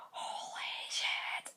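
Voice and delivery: slow stunned whisper; awed whisper. Retold or imitated. Sister after meeting her idol, a starstruck whisper. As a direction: breathe it out in disbelief. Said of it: "did that exact same face again".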